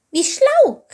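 very high, loud squeal of delight; excited cry. A narrator's reading. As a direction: call out thrilled in a tiny high voice. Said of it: "rief Chilp begeistert".

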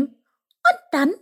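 high, eager little voice; excited question. A narrator's reading. Chip wants the next part, excited high question. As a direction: ask it excitedly in a high squeak. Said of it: "fragte Chip aufgeregt".

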